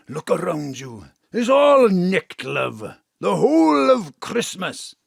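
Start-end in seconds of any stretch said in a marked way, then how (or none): 1.3-5.0 high, loud, slow boast; brash bragging; boastful shout. Acted. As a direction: crow about it. Dad boasting loudly.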